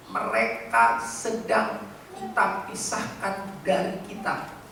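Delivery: ordinary delivery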